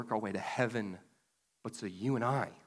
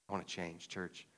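softer, earnest echo of the line; quieter repeat; 5 semitones lower, 10 dB quieter than usual. Real, not acted. Pastor repeating his confession to the church, softly. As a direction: drop the voice and mean it.

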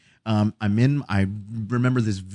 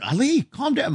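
higher-pitched imitation of a sibling; worried sibling. Brother or sister to sobbing child, raised imitated voice. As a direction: quote someone calming a sobbing kid. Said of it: "My brother and sister come in".

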